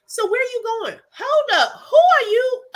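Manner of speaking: high, loud startled question. Retold or imitated. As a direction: jump back in alarm. Confronted by stranger, retold reaction.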